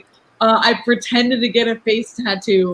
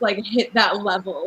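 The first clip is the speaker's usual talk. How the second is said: ordinary delivery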